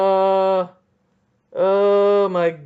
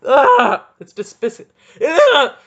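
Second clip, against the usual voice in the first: frustrated yell; 8 semitones higher, 9 dB louder, slower than usual. Real, not acted. Frustrated rant, word stuck.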